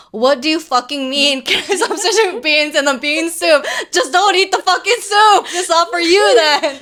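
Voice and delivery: high, loud rant breaking into laughter; hammed-up indignant yelling; mock outrage. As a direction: rail like an exasperated commenter, half laughing. Acts out irate commenter, laughing.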